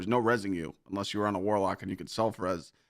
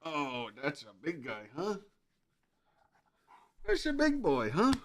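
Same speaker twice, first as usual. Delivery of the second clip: puppy talk; 7 semitones higher, 4 dB quieter than usual. Real, not acted. Streamer coaxing his puppy to come say hi, high cooing baby talk. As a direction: coo at the dog to coax it over.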